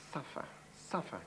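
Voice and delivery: soft, disbelieving repeat of Suffer; incredulous muttered echo. Acted. [name] repeating the official's order to herself, quiet disbelief. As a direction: echo Suffer under your breath, quietly stunned. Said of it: "Did I suffer?".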